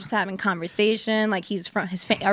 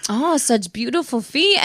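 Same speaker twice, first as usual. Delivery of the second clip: slow, cooing admirer voice; Ludwig; 3 semitones higher, slower than usual. Retold or imitated. Man from Amsterdam admires her feet, cooing impression. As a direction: imitate him, savoring each word. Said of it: "And then he's just like".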